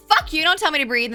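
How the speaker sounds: acted outburst, loud and high; annoyed retort. Annoyed at being told to breathe, mock angry shout. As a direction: snap back in mock fury at advice. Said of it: "I used to get so annoyed".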